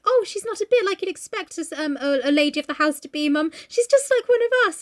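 innocent, kindly gushing; sweetly meant. Retold or imitated. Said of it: "she means it really sweetly".

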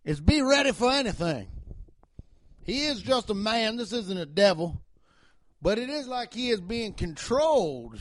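Southern cop voice. Acted. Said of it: "I do that Southern voice".

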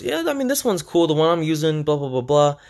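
high, lightly voiced breezy brother-in-law; brother-in-law impression. Brother-in-law brushing off the question, high and casual. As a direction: toss it off high and casual like the know-it-all relative. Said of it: "And he goes".

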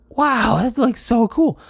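high, loud reenacted wow; amazed delight. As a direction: gush like an awestruck kid. Retold delight at free ice cream.

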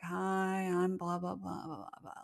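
low, dull, fading door-to-door pitch, lightly voiced; a hint of a bored salesperson reciting the same pitch; monotone sales pitch. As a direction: drone through the pitch like you've given up. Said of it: "Like, really monotone".

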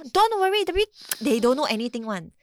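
high, loud imitated brush-off; parroting a careless adult; mock dismissive reassurance. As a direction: start high and loud, mocking the excuse. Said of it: "I know it's funny".

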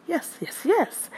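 high, rising squeal of joy; bursting with delight at her own news; excited yes. Real, not acted. Host tells listeners her big news, squealing with excitement. Thrilled about announcing her movie.

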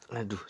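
rough throat clear. Real, not acted. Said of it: "cegukan lagi".

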